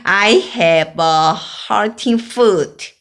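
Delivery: loud call from far behind; shouting. Acted. Bella far behind, shouting to the neighbour. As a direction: shout it up the street. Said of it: "so I had to shout".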